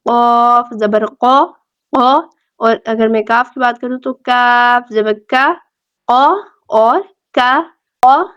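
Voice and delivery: قو from the throat, کا from the mouth; qaf versus kaf. Shown as a demonstration. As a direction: contrast full قو with thin کا. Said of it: "قاف پورا اور موٹا پڑھا جائے گا".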